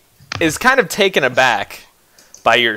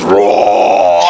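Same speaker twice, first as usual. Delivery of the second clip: loud, rough battle roar; 6 semitones lower, 6 dB louder than usual. Acted. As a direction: roar like an enraged smith. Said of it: "And as he roars".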